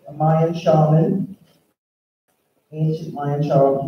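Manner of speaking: normal talking voice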